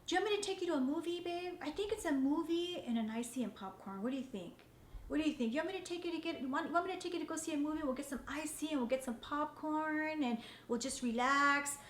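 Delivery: husband hugging his wife, sweetly offering a movie; sweet tender offer. Retold or imitated. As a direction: pamper her with every word. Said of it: "he'll hug me ever so sweetly".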